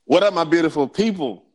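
big, loud, high podcast hello; hype greeting. Real, not acted. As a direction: shout out to the audience. Opening the podcast, hyping listeners.

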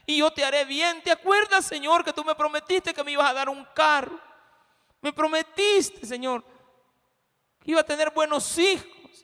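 high, loud whining prayer to God, slowing on the reproaches; mock Jacob scolding God; preacher hamming up Jacob telling God he was wrong; complaining prayer. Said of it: "Como que está diciéndole a Dios, mira, te equivocaste".

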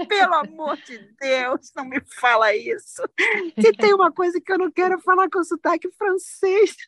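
exaggerated dismay, half laughing; mock horrified protest. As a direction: protest the compliment in playful horror. Protests teacher's compliment on accent.

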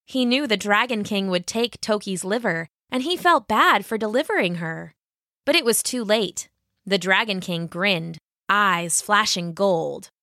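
ordinary delivery

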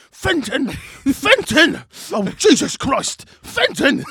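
high, loud frantic calling after a dog; old man yelling at a runaway dog; panicked shouting. Retold or imitated. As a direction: bellow the dog's name in despair. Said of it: "this old man is literally running by going".

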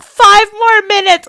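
loud, high-pitched protest; mock-childish pleading yell; kid begging mom. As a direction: whine it like a kid dragged out of bed. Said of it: "my mom walking into my bedroom".

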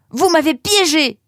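high, loud shout of accusation; furious yell. A narrator's reading. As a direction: yell it across the garden, betrayed. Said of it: "hurla-t-il en direction de la serre".

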